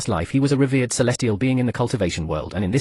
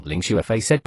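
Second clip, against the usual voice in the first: usual speaking voice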